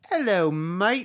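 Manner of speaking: high, slow, loud fake Cockney; bad Cockney accent. Shown as a demonstration. Host retrying the accent for co-host, bad Cockney. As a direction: try out a hammed-up Cockney greeting. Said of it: "Let me try again".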